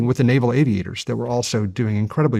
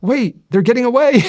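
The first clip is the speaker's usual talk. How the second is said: high, loud acted yell; screaming out; 12 semitones higher, 6 dB louder than usual. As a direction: imitate a sailor screaming after a fleeing enemy. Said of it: "was screaming out".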